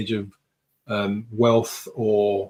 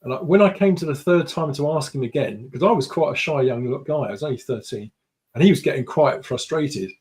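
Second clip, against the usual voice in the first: normal talking voice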